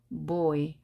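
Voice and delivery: ordinary talk, nothing marked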